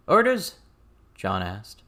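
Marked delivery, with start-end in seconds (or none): 0.1-0.6 higher and louder single word; terse soldier asking orders; brisk request for orders; John's clipped question. A narrator's reading. John asks for orders.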